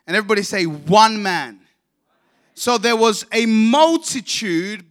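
fiery pulpit reading; preaching at full force. Acted. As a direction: proclaim it to the whole congregation. Preaches the scripture reading loudly.

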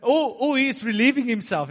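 high, loud, gleeful jeer; hammed-up mockery; mocking taunt. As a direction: tease them with a silly suggestion. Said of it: "Elijah mocked them".

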